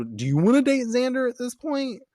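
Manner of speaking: high, sassy mock-suspicious voice; voicing Anya as a sassy gossip. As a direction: pitch it up and get nosy, like a gossiping friend. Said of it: "she's a little".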